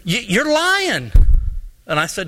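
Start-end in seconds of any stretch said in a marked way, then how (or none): 0.1-1.1 high, slow, drawn-out mock accusation; stretched-out aggrieved charge; retold accusation. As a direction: act out an indignant man calling someone out. Junior accuses again.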